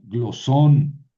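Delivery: glosón with a loud, long -SÓN; stress on last syllable. Shown as a demonstration. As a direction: push the accent onto the end of glosón. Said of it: "el acento se va a ir a la última". (glosón con acento fuerte en la última sílaba)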